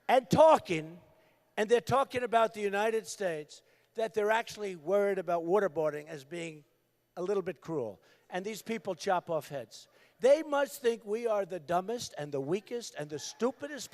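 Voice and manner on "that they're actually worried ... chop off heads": normal talking voice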